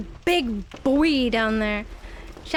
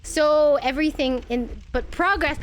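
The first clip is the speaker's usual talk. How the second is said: ordinary talk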